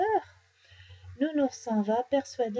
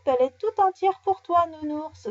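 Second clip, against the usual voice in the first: higher, louder teasing jeer; mocking; 5 semitones higher, 4 dB louder than usual. A narrator's reading. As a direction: tease with a raised, punchy voice. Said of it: "se moque Nicolas".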